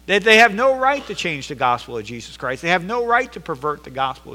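loud, high, driving pulpit delivery; fiery sermon climax; full-force preaching. Acted. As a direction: preach it hard to the congregation.

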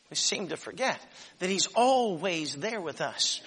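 usual speaking voice

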